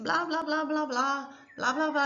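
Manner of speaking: a light mock of chattering mental noise; nagging inner chatter. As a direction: mimic the nagging voices in your head. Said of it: "ele fica falando na tua cabeça".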